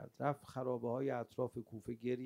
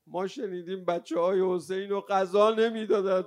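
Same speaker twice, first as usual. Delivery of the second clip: loud, high grieving cry; wailing lament; 6 semitones higher, 14 dB louder than usual. Real, not acted. Preacher mourning Hussein's hungry children, wailing lament. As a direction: burst out in a mourning wail. Eulogist cries over starving children.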